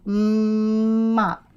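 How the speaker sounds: "Mop" with a long, held mmm; stretched-out word. Shown as a demonstration. Teacher modelling for a pupil, "Mop" stretched out slowly. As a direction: draw out the M in "Mop" before finishing it. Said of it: "Stretch it out".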